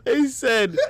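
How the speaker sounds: slowed down, breaking into laughter; can barely finish for laughing. Real, not acted.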